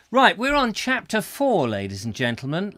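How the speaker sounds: loud, high call to attention; rally the audience back to the reading. Real, not acted. Host calling viewers back to order, loud.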